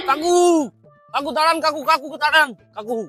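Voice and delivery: calling out. Acted. Drunk calling after man.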